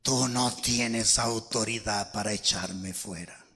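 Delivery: deep, slow demon growl; deep, slow demonic voice. Retold or imitated. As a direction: drag it out low like a demon. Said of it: "El demonio me habló y me dijo".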